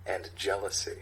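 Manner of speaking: normal talking voice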